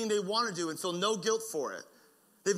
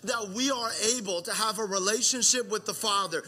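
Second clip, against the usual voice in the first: preaching at full force; 4 semitones higher than usual. Acted. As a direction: drive the gospel home to the congregation. Sermon climax builds.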